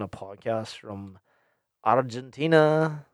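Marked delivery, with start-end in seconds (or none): 1.8-3.1 louder, higher, drawn-out mock-Argentinian accent; put-on accent. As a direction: say it slowly in a badly faked Argentinian accent. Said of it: "why I put on that accent".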